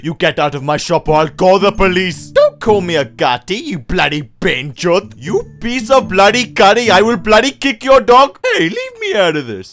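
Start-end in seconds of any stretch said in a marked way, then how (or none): 0.0-2.3 put-on loud, angry shopkeeper in Indian accent. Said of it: "making fun of the way I talk". 5.2-8.4 put-on loud, angry shopkeeper in Indian accent. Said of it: "making fun of the way I talk".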